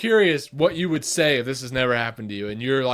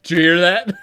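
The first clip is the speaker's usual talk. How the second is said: louder, higher alarmed question; urgent question; 5 semitones higher, 12 dB louder than usual. Retold or imitated. Waking his wife over a noise, loud alarmed. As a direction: jolt her awake with it.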